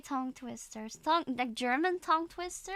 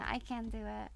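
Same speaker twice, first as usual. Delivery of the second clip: ordinary talk, nothing marked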